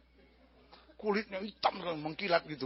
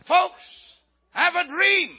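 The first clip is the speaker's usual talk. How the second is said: loud, high mock-grand English proclamation; announcing king; 7 semitones higher, 9 dB louder, much slower than usual. King addressing his wise men, loud comic proclamation. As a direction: call out like a pompous ruler summoning his experts. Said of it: "dia panggil orang pintar, dia bilang".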